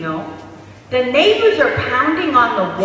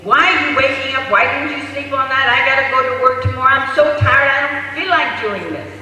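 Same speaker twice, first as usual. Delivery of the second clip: loud, higher, exasperated mother rant; acted outburst of a worn-out parent; yelling at baby; 4 semitones higher, 6 dB louder than usual. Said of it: "yells at the baby".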